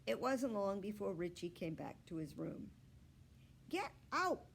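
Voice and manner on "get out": put-on higher boy voice shooing. A narrator's reading. Boy shooing the puppy from his room. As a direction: shoo the dog out. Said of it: "he shooed her out".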